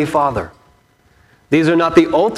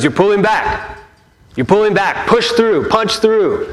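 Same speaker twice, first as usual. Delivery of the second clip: higher, urging coach calls; coaching sensei; 6 semitones higher than usual. Retold or imitated. Sensei urging the boy at the board, lightly voiced higher calls. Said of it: "and he says".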